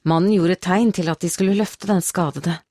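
usual speaking voice

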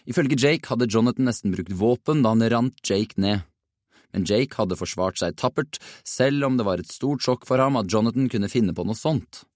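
plain reading voice

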